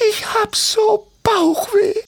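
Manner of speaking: sehr hohe, langsame Vogelstimme voller Bauchweh; jammernd. Acted. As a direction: leidend piepsen. Doris jammert. (Doris klagt Timmy ihr Bauchweh, hoch und langsam)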